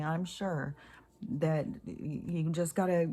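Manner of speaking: usual speaking voice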